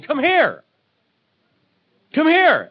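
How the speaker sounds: high, loud repeated summons; urgent calling. Acted. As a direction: call them to come, insistently. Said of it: "That's what Jesus is saying".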